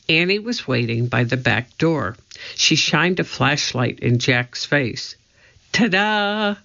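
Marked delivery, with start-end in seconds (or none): 5.7-6.6 high, showy flourish; playful ta-da. A narrator's reading. Annie shows Jack her flashlight as a wand, playful. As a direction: present it like a magic trick.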